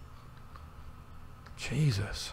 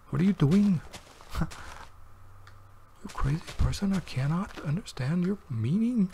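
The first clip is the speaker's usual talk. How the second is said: lightly voiced higher imitation of her scolding; imitating her; 7 semitones higher than usual. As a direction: mimic her gentle bewildered scolding, pitched up. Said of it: "she would accompany it with words like".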